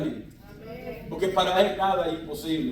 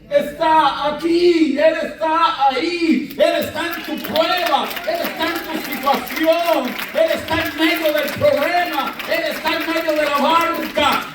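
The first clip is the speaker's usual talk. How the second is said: fervent preaching shout; 9 semitones higher than usual. Acted. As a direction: build to a fiery proclamation. Clímax de la predicación. (proclamando a gritos que Él está aquí)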